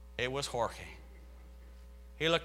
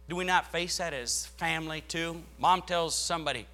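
usual speaking voice

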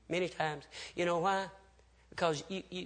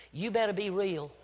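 softer, measured warning; quiet admonition; 7 dB quieter than usual. Real, not acted. Preacher cautions parents to be real, softly and firmly.

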